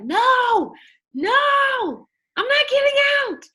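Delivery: high, loud stubborn shout; defiant refusal. Retold or imitated. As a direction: yell no and refuse to budge. Refusing mom in the tub.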